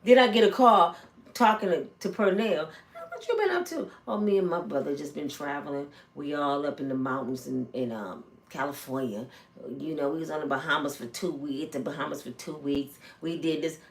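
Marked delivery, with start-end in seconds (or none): 3.1-3.9 higher, breezy phone hello; casual high greeting. Retold or imitated. Phone call to Pernell. 4.1-13.8 lower, soft cagey cover story; lowered evasive friend. Retold or imitated. Pernell dodging with cover story.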